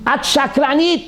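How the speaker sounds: high-pitched imitated accusation; retold yelling. Shaking rabbi accusing the woman, retold yelling. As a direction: throw it out as a mock yell. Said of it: "and he starts yelling".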